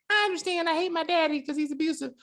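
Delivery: put-on high, babyish child voice; mock the sweet kid delivery; fake kid voice. Imitating the child actress to a cohost. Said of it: "that child voice".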